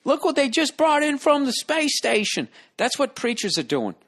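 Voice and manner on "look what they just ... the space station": much higher, breathless pitchman call; excited showman. Retold or imitated. Guy showing off space station goods, high excited. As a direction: hype the find like a carnival barker. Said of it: "that guy's standing out there going".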